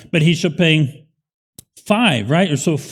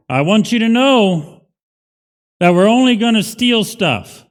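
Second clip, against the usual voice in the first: mock thief's announcement; 8 semitones higher, 6 dB louder than usual. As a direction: announce it like an absurdly candid burglar. Imagined thief announcing intentions up front.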